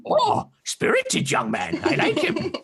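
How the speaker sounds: put-on high, curious old turtle creature voice; a wary hermit peering at strangers; old turtle man. GM voicing the old turtle hermit to the party.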